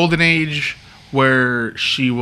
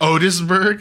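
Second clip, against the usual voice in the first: rising, raised quizzical "Otisburg?"; puzzled question; 6 semitones higher, 4 dB louder, slower than usual. Retold or imitated. Lex asking his minion Otis, quizzical. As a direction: ask "Otisburg?" in mock disbelief, pitch going up. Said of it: "He's like".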